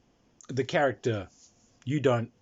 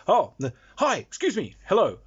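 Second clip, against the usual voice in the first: calling hello; 7 semitones higher, 7 dB louder than usual. Acted. Hailing the peasants.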